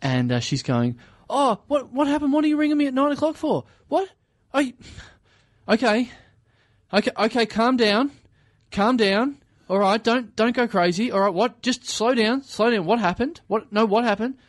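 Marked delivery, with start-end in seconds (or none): 1.3-4.7 high-pitched, puzzled questions; retold high voice, startled by the call; surprised phone answer. Girlfriend answering unexpected early call. 5.7-14.3 high-pitched, urgent reassurance; retold phone voice, high and insistent; calming down a caller. Girlfriend calming upset sister by phone.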